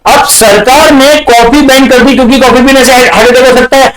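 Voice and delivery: high, sustained one-note rush; hammed-up high-pitched recital; mock excited repeat. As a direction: rattle it off in a high-pitched chant. Same problem repeated as excited reaction.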